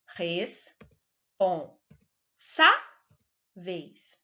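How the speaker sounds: slow sounding-out of "Responsáveis" with long pauses; syllable by syllable. Shown as a demonstration. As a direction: break "Responsáveis" into four pieces, lifting SÁ. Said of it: "a sílaba tônica é a penúltima".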